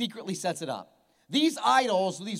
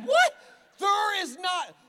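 high, squeaky what; mock disbelief; 9 semitones higher, 5 dB louder, slower than usual. Pastor hears washer said, high mock shock. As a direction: play the shock up big.

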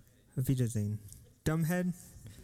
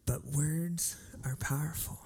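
soft whispered refrain, softer; 4 dB quieter than usual. Real, not acted. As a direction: lean in and whisper the point.

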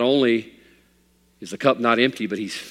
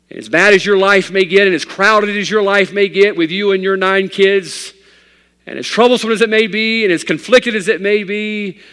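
loud and high, driving the point home; preaching shout; 8 semitones higher, 8 dB louder than usual. Real, not acted. Preacher to his flock, hitting the climax loud. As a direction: lift it into a full-force preaching cry.

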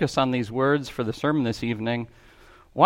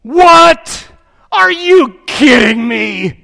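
loud, high, slower outraged cry; outraged businessman; 11 semitones higher, 12 dB louder, much slower than usual. Acted. Businessman discovering his vandalized car, loud outraged cry. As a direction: blurt it like a man finding his car stripped. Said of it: "The businessman".

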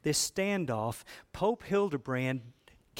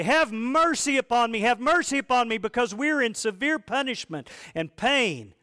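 raised, louder pleading cry of the dead; mock wailing plea; 8 semitones higher, 6 dB louder than usual. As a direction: beg for mercy like a wailing soul. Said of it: "the voices of your wailing dead parents".

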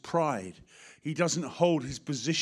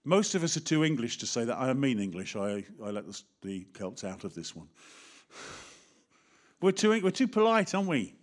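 usual speaking voice

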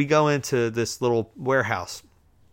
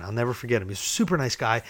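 ordinary talk, nothing marked

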